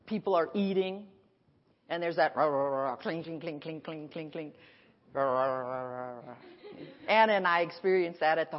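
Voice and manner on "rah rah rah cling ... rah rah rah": low murmured rah and cling noises; soft crowd chatter and clinking glasses; imitated restaurant din. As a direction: mimic the clatter of a busy dinner.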